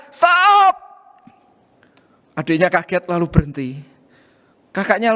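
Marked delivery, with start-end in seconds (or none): 0.1-0.7 a sharp, much higher shouted stop; sudden shout. Acted. Older sister catches her sister scribbling on the drawing, shouts. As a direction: yell it to stop the little sister at once. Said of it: "langsung berteriak".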